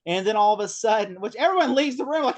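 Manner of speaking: ordinary talk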